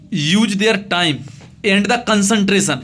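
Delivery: very slow, each word set apart; slow dictation. Shown as a demonstration. Teacher reading the letter line to students, very slow. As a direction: dictate it so they can copy it. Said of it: "teacher dictating letter slowly".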